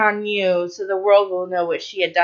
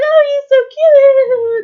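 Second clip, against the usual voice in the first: very high, slow, louder mock swoon; swooning girly voice; 6 dB louder, slower than usual. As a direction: gush like a smitten girl, hammed up. Soldiers acting swooning girls.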